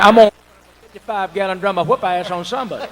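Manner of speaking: ordinary talk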